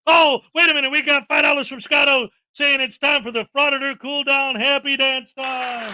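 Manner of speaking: loud, high hype call; excited announcement. Real, not acted. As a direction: announce it like a prize. Announcing a donation segment.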